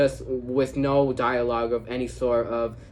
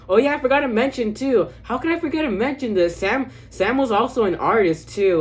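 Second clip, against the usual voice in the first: higher, louder burst of sudden recall; sudden remembering; 10 semitones higher, 5 dB louder than usual. Real, not acted. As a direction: blurt it out as it comes back to you.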